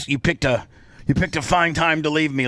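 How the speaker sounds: usual speaking voice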